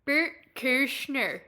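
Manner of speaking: slow, drawn-out Canadian accent. Shown as a demonstration. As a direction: draw the name out like a Canadian. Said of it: "You just automatically get a Canadian accent".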